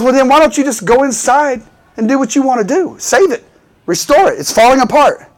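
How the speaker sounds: urging passerby. Acted. Acted passerby urging the owner.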